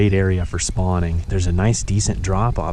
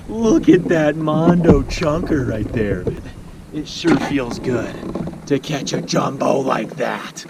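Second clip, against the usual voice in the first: goofy voice; 4 semitones higher, slower than usual. Pretend. Admiring big catch.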